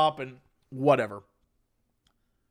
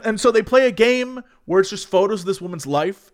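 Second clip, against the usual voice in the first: normal talking voice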